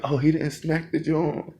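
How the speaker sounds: high, slow astonished gasp-line; mock shocked recruits. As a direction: stretch it out in disbelief. Everyone reacting in shock.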